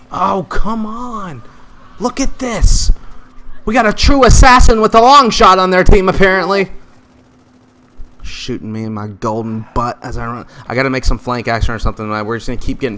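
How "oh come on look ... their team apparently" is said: exasperated. Real, not acted. Annoyed at enemy sniper.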